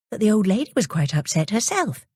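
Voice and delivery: usual speaking voice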